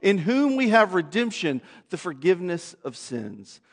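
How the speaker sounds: soft, low, reverent prayer voice. Acted. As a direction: pray quietly over the church. Leads a closing prayer.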